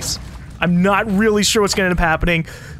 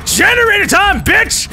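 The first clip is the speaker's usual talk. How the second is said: high, loud battle cry; hype shout; 12 semitones higher, 4 dB louder, slower than usual. Real, not acted. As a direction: pump yourself up and yell. Psyching up before the run.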